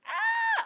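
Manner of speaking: sudden high yelp of joy at the play; excited scream. Real, not acted. Streamer yelping at the Bills game. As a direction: shriek as the Bills break through.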